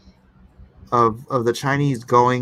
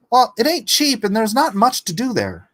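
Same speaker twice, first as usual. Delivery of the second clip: shrugging retort; 10 semitones higher than usual. Real, not acted. Retorts to a chat comment.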